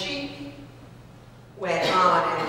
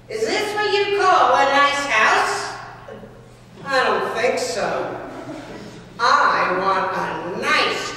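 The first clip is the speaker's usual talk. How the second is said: loud, higher, laughing scornful demand; bully him into going back; demanding wife; 6 semitones higher, 7 dB louder than usual. Acted. Wife demands a bigger house.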